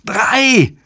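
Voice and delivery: very high, piercing narrated screech; screeched shout. A narrator's reading. David yelling from Spain into the microphone, high screech. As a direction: screech the word out at the top of your pitch. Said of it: "kreischte Davids Stimme".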